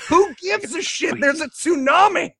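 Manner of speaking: high, comic shouting outrage; exasperated rant. Retold or imitated. Viewer fed up with trivial Jesus questions, exasperated shout. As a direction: shriek it in mock disbelief.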